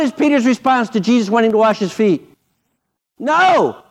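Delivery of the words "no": sharp high no; quick high-pitched refusal. Retold or imitated. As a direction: blurt it out, refusing. Peter refusing Jesus.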